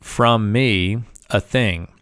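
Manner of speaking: very slow, word-by-word gloss; slow deliberate reading. Shown as a demonstration. Teacher slowly reading the highlighted line to the class. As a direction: draw out each word of the clause. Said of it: "already highlighted".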